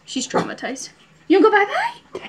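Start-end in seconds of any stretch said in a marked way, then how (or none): 1.3-2.3 put-on high cooing baby talk. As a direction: coo it high and bright.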